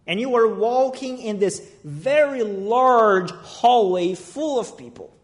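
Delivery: usual speaking voice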